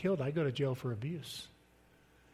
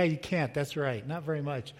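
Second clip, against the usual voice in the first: ordinary talk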